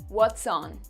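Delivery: ordinary talk, nothing marked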